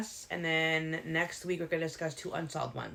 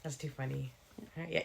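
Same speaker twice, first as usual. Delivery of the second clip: ordinary talk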